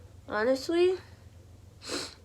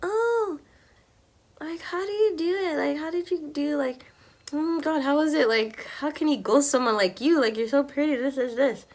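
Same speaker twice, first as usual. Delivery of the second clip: higher-pitched mimicry of curious people; imitated questioners; 7 semitones higher than usual. People asking her how he could ghost her, imitated questioners. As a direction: put on the high voice of people always asking. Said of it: "People always be asking me".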